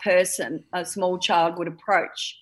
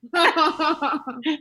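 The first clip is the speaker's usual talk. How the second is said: high laugh; 6 semitones higher than usual. Real, not acted. Mother laughing at story.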